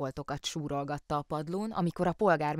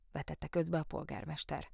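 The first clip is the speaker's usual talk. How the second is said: plain reading voice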